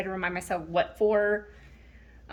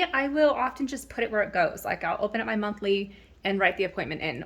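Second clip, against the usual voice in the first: ordinary delivery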